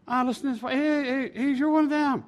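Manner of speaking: high acted voice of discovery; accusing bystander. Bystanders pointing out Peter, high-pitched accusation. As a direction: pitch it way up and single him out.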